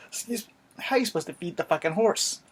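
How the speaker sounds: ordinary talk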